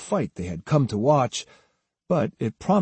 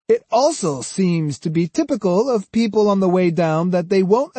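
higher, snide superiority; 8 semitones higher, 3 dB louder than usual. A narrator's reading. Swordsman scorning men on the way down, snide. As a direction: needle them with mock regret. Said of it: "Kojiro's tone became snide".

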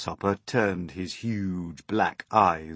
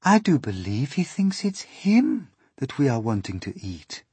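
higher, swooping ladybird voice; concerned ladybird; 7 semitones higher, 6 dB louder than usual. Acted. Ladybird realising James fears being eaten, pitying voice. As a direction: pitch it up, falling away at the end.

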